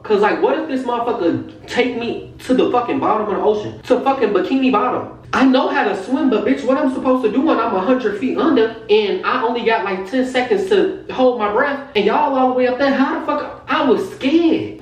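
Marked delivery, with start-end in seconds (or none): 5.3-14.8 high-pitched retold panic; scared. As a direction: protest in comic alarm, pitched high.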